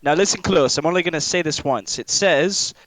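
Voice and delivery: addressing the group. Acted. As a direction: call the group in close for a one-time message. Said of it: "turns to the rest of the group and he says".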